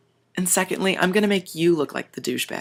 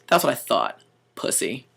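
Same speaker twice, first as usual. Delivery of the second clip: lower, softer, dismissive sneer; dad's taunt; 5 semitones lower, 5 dB quieter than usual. Retold or imitated. Tough dad taunting the backing-down manager, low and cold.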